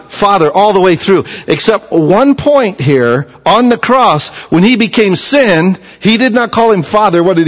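preaching at full force. Acted. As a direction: preach it, climbing in fervour. Preacher builds to full force again.

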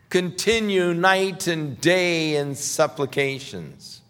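high, loud and slow, pleading with the mothers; fervent preaching. Acted. Preacher voicing Jeremiah to the praying mothers, loud and slow. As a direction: cry it out slowly like a prophet.

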